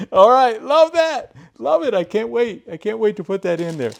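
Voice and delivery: laughing, high, loud burst of joy; real excited squeals of delight. Real, not acted. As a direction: gush over the new find, giggling. Thrilled about the new extension.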